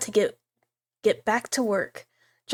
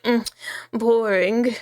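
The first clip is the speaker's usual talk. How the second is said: mock judgy sigh; much slower than usual. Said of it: "every book he's just like".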